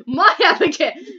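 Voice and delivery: loud, high claim broken by laughter; laughing possessive protest. Pretend. Friend jokingly claiming the same game crush, loud and laughing. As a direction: claim the crush while cracking up. Mock rivalry over Abigail.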